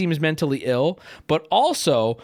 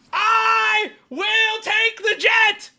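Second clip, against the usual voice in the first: very high, slow, stretched-out yell; over-the-top high-pitched acceptance; mock ecstatic shout; 3 dB louder, much slower than usual. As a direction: holler it word by word, hugely drawn out. Mock acceptance of offered jet.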